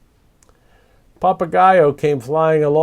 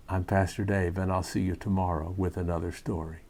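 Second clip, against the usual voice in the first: gentle and reassuring; 4 semitones lower, 7 dB quieter than usual. Real, not acted. Pastor speaks gently to children.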